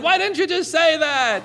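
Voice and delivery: very high, loud comic protest; mock exasperated shout. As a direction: imitate a husband yelling back, hammed-up. Acted quarrel, husband yells back.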